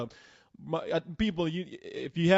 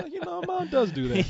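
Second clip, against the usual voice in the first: sheepish higher-voiced admission; 10 semitones higher than usual. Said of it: "I was like".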